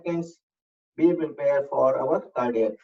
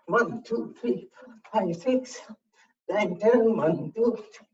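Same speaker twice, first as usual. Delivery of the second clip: high, punchy counting of each rep; workout count; 6 semitones higher, slower than usual. Acted. Fitness coach counting reps for the class, high and punchy. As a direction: call out the reps to keep the class moving.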